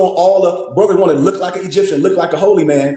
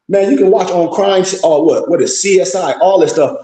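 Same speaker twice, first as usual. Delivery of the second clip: ordinary talk, nothing marked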